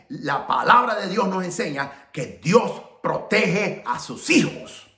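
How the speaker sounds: big pulpit delivery with long pauses; impassioned preaching. Acted. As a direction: preach it forcefully, leaning on key words. Preaches to the congregation.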